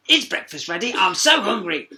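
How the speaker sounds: cheeky hungry frog. A narrator's reading. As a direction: ask eagerly as the frog. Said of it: "the frog reminded her".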